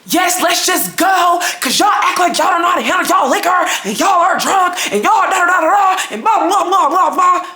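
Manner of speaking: high-pitched imitation of drunken hollering; mock yelling. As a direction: mimic her aggravating, high screaming rant. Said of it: "yelling, screaming, hollering".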